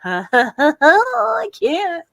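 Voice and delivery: half-crying whimper of fan overwhelm; mock overwhelmed cry. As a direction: pretend to break down from excitement. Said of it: "and I'm just like".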